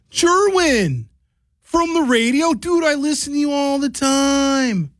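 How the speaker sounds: very high, drawn-out gushing; excited fan. Retold or imitated.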